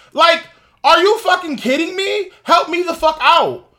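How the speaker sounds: high, loud disbelieving yell; outraged shout. Real, not acted. Vlogger yelling at the woman in the video, outraged. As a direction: yell your outrage at her.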